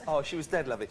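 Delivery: ordinary delivery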